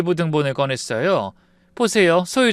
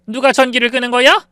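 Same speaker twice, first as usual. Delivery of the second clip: high, loud demanding shout; angry shout; 9 semitones higher, 6 dB louder than usual. Acted. 시어머니가 전기 끈 사람에게 높고 크게 소리침. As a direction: yell out the door at whoever did it. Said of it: "시어머니가 소리쳤습니다".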